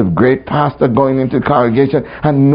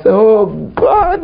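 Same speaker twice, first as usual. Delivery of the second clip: higher, retold cry to God; mock lament; 9 semitones higher than usual. Preacher replaying an accusation of God, mock lament. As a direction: lift it into a mild wail. Replays own complaint against calm answer.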